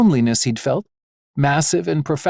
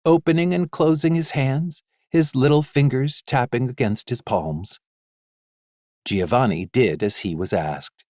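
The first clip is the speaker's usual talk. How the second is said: plain reading voice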